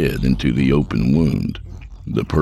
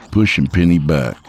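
ordinary delivery

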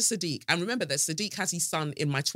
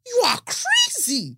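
loud, high-pitched angry mum demanding a refund; mum on the phone; 3 dB louder than usual. Retold or imitated. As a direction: shriek at the agent like an outraged mother. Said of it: "get my mum to call and do the whole".

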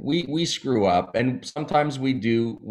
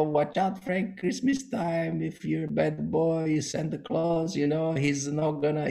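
higher, hushed voice of a parent warning; mock spooky parent warning; 6 semitones higher, 5 dB quieter than usual. Parent warning young Frank about Santa, hushed and spooky. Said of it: "parents scared me".

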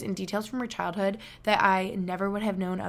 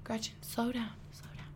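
soft parental nudge; 2 semitones higher, 12 dB quieter than usual. Retold or imitated. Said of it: "My mom and dad would tell me".

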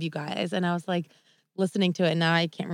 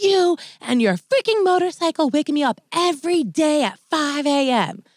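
high, loud sassy tirade; super sassy; 10 semitones higher, 5 dB louder than usual. Retold or imitated. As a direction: imitate an outraged, sassy neighbor yelling.

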